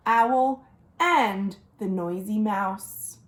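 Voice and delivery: plain reading voice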